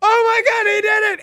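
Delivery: high, shrieking disbelief; ecstatic screaming. Retold or imitated. Roommates screaming as Jay Bruce clinches the division, ecstatic. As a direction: scream it like the pennant just got clinched. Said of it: "an accurate assessment of how it sounded".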